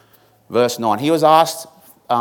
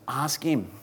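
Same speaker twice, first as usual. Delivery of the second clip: hushed, whispered emphasis; 9 dB quieter than usual. Real, not acted. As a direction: drop to a whisper for weight. Hushed emphasis on scripture's key phrase.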